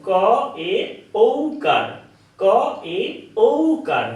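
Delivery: ক, এ, ঔকার, each spaced apart, ঔকার high; teacher spelling ক এ ঔকার piece by piece; syllable by syllable. Shown as a demonstration. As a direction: spell out ক এ ঔকার slowly for a child. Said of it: "ঠিক এইভাবে বোঝাবো".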